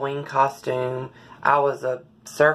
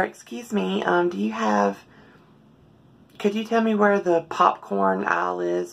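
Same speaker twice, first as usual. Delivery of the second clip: higher, soft, feminine-pitched greeting voice; soft public voice; 6 semitones higher than usual. Shown as a demonstration. Showing viewers her public voice, soft and high-pitched. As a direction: lift the voice up high and keep it soft and light. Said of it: "I speak in a very soft, high-pitched voice".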